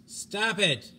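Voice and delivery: shouted order. Acted. Hollis yelling at a screaming crewman, loud desperate shout. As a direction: yell at the screaming man to stop.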